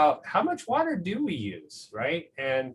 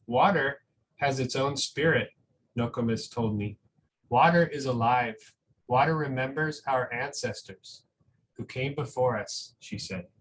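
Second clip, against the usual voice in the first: put-on reading to children; 3 semitones lower than usual. Reads a picture book aloud.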